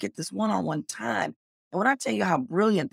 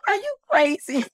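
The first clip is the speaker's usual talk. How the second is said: husband's disbelief; 12 semitones higher than usual. Retold or imitated. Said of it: "And Tony was like".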